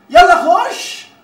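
loud, high, drawn-out push to go; urging shout. Acted. Colleague sends the reluctant spokesman in to the boss, urging shout. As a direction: shout him on through the door. Pushes colleague into boss's office.

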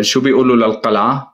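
ordinary talk

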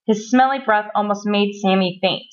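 plain reading voice

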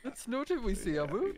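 high-pitched mocking Canadian, "about" as "aboot"; exaggerated Canadian copy of the protest; mock Canadian accent. Teasing a Canadian friend's protest, mock Canadian accent. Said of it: "Canadians say a boot".

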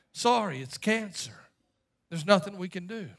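softly delivered bad news; grave doctor. Doctor telling a patient it's cancer, grave and quiet. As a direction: drop soft and low, a doctor breaking bad news.

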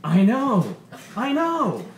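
high, loud, stretched exclamation; loud mock disbelief. A narrator's reading. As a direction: ride the crowd's shock. Comic reacts to crowd.

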